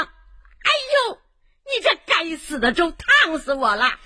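sudden high ouch, then a pained complaint; mother cries out in pain; scalded yelp. Acted. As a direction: yelp as hot porridge burns her hand. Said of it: "粥溅到了妈妈的手上".

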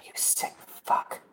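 slow teasing whisper. Pretend. Host teasing co-host about liking it, slow whisper. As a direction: breathe it out slow and conspiratorial.

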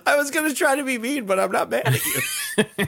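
high-pitched, laughing through the words; laughing backdown. Real, not acted. Friend giving up on insulting his co-host, laughing. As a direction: drop the mean act and crack up.

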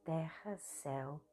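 soft, unhurried meditation voice with long pauses; hushed, even meditation guidance; guided meditation. Acted. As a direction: guide a listener gently through body movements, leaving space between phrases.

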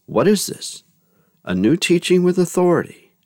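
high, astonished scripture quote; amazed. A narrator's reading. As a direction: read it as an amazed crowd, pitch leaping up. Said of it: "All were amazed and asked".

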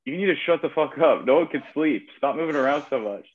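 half-naked bunkmate scolding the restless guy above; angry bunkmate. Retold or imitated. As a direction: tell him off, fed up. Bunkmate tells off noisy sleeper.

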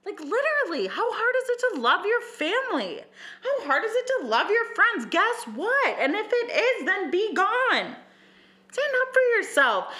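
high, worked-up rant; a high, exasperated lecture to cheaters; fired-up rant. Real, not acted. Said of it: "I'm pissed off".

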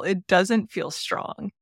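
ordinary talk, nothing marked

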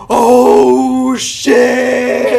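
loud, high, drawn-out amazement; stretched mock-stunned reaction; awed exclamation. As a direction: let it swell like an impressed audience. Acts out viewers' awed reaction.

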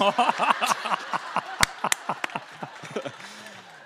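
long, airy, high laugh without voice; breathy laughter. Real, not acted. Author cracking up at the toilet-line punchline, breathless laugh. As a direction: collapse into silent laughter.

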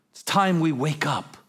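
ordinary talk

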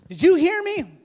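high, shouted mock-wife demand; exasperated wife. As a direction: yell it at an inattentive husband. Exasperated wife confronting husband, acted.

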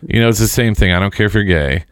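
ordinary talk, nothing marked

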